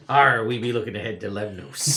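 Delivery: loud, hammed-up pirate; loud pirate growl-talk; pirate sea captain. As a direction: play a booming old sea dog. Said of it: "only way I know how to play sea people".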